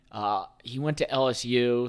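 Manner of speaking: ordinary delivery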